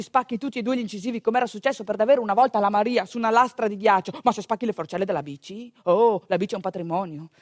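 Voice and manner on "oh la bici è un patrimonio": ordinary talk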